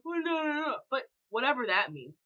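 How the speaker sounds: ordinary talk, nothing marked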